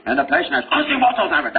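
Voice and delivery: put-on sudden high, fast Japanese-accented outburst; hammed-up guttural Japanese accent; mock Japanese accent. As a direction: imitate an aggressive Japanese-accented speaker erupting mid-talk. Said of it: "and then they say".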